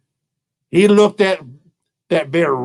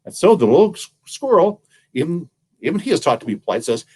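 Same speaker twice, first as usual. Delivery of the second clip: ordinary delivery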